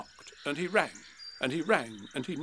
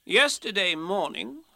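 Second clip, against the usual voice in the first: slow, raised-pitch solemn announcement; solemn storybook Rabbit; 5 semitones higher, 3 dB louder, slower than usual. Acted. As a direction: begin a grave account, slowly. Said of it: "said Rabbit solemnly".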